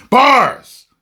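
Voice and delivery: high, loud shout of "Bars!"; loud triumphant hype call; proud exclamation. Real, not acted. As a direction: crow over your own rhyme. Celebrates his own rhyme.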